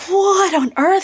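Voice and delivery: high, incredulous exclamation; shocked. Retold or imitated. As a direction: exclaim it in disbelief. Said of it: "I was like".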